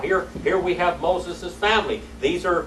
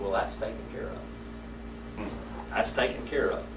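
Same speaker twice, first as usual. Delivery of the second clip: dry quiet aside; 5 semitones lower, 11 dB quieter than usual. Retold or imitated. Marshal's calm remark after shooting.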